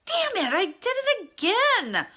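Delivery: high, loud frustrated outburst at herself; exasperated self-scolding. Retold or imitated. Scolding herself for ego slip again, a high loud exasperated cry. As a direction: catch yourself in a mistake, high and loud. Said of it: "And I'm like".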